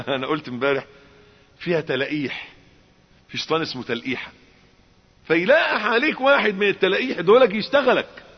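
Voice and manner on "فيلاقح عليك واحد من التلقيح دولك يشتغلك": preaching build. Acted.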